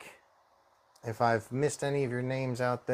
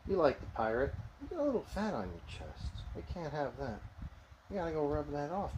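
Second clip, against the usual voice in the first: squeaky praising a dog; 2 semitones higher, 10 dB quieter than usual. Real, not acted. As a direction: coo praise at your dog. Talking to his dog Spike.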